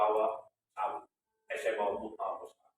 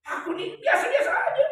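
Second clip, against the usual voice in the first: laughing disbelief; 11 dB louder than usual. Retold or imitated. Asking God why me.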